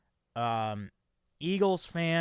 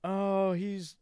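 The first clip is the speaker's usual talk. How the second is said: mock pop singer; 5 semitones higher, slower than usual. Mocking [name] lyrics.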